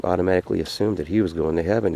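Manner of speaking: ordinary delivery, nothing marked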